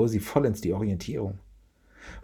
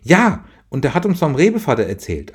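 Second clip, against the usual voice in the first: put-on higher, eager child's voice; little girl Franzi; 7 semitones higher, 7 dB louder than usual. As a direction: play the hopeful little daughter.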